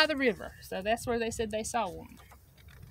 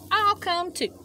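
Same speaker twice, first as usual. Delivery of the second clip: eager Rabbit; 8 semitones higher, 5 dB louder than usual. Acted.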